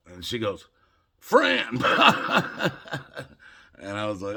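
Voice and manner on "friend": high, loud imitated woman breaking into laughter; laughing punchline. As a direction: blurt it high and loud, then crack up.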